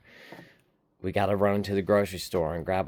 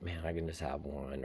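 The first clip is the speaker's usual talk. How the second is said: quiet; 4 semitones lower, 11 dB quieter than usual. Retold or imitated. Retold craving thought.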